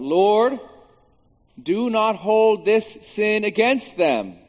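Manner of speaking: loud, high, drawn-out cry; retold yell. Preacher voicing Stephen's dying plea for his attackers, yelled. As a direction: cry it out slowly to be heard. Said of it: "And he yells out".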